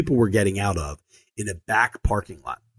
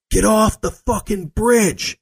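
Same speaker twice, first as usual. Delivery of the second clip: much higher, slow, drawn-out furious shout; angry yell; 11 semitones higher, 4 dB louder, much slower than usual. Retold or imitated. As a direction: roar it at him to get off. Guard yelling retold.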